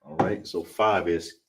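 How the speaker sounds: usual speaking voice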